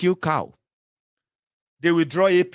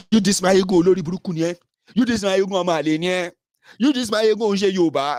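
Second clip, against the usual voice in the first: mock insults; 5 semitones higher, 5 dB louder than usual. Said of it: "everybody will come there".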